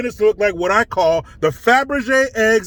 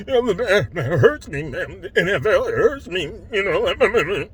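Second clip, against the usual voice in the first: choked-up imitation; 3 semitones lower, 3 dB quieter than usual. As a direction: mimic a grown man getting choked up. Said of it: "gets choked up".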